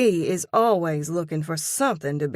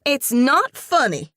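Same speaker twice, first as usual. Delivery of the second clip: louder, higher reproach; countering; 8 semitones higher, 7 dB louder than usual. A narrator's reading. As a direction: scold the laugh. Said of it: "Ellie counters".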